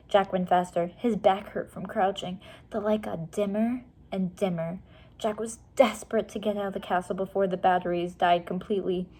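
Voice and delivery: plain reading voice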